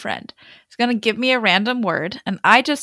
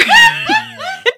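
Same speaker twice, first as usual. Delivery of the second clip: loud, high laughing fit; high burst of laughter; 7 dB louder than usual. Real, not acted. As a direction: crack up at your own story. Laughing after the scream.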